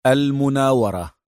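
ordinary delivery, nothing marked

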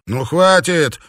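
loud, high angry bark; sharp shouted command. A narrator's reading. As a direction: bark it to break up a fight. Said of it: "рявкнул стоящий у двери Юлиус".

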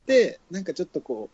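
ordinary talk, nothing marked

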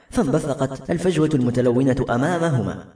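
ordinary delivery, nothing marked

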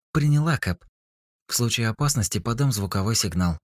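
high, bright female AI voice. A narrator's reading. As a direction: answer like a helpful AI. AI Eva answers.

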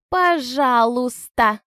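slow, drawn-out mannered "пожалуйста"; affected. Acted. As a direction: stretch it with a martyr's eye-roll. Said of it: "манерно произнесла Анжела".

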